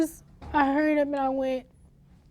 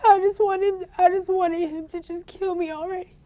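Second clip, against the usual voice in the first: real sobbing, pitched high and louder; crying; 7 semitones higher, 8 dB louder than usual. Real, not acted. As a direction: break down crying while telling it. Survivor retelling brother's killing.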